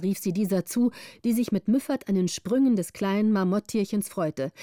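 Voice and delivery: plain reading voice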